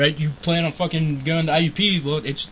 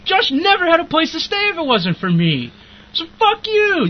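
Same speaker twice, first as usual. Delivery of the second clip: much higher and louder, full-throated fury; furious yelling; 12 semitones higher, 4 dB louder than usual. Retold or imitated. Reliving the fight with his aunt, yelling much higher and louder. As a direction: roar it like you're back in that car screaming.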